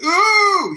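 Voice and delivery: high shouted ooh of disgust; loud "ooh". Retold or imitated. Sergeant reacts to recruit's dirty hands, shouting ooh. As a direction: imitate a big appalled ooh.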